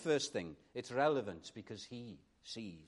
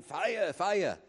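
moderately raised mock alarm shout; imitated cry of alarm; 4 semitones higher, 5 dB louder than usual. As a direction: cry it down the street, half-shouted. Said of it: "went down the street crying".

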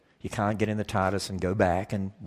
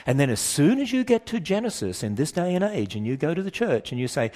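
ordinary delivery

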